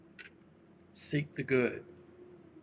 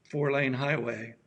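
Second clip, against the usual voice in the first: usual speaking voice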